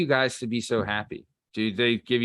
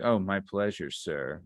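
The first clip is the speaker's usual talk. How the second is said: creepy fake politeness; 5 semitones lower, 6 dB quieter than usual. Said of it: "you're like".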